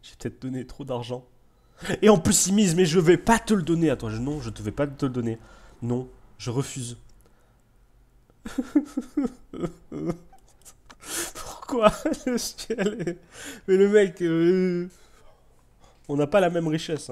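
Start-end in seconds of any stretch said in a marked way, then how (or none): 2.0-4.1 loud, much higher indignant refusal; loud, high indignant outburst. Real, not acted. Outbid again, indignant. 8.4-10.2 high-pitched disbelieving laugh; high incredulous laughter. Real, not acted. Laughs at being outbid. 11.3-17.1 much higher, half-laughing complaint; higher, laughing protest; laughing disbelief. Real, not acted. Laughing at rival's wealth.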